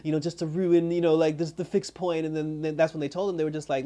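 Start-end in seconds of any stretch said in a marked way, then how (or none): 0.1-2.2 higher-pitched mock reproach; imitating someone put out; retold unhappy complaint. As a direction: act out her grievance, pitched up. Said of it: "she wasn't happy 'cause she was just like".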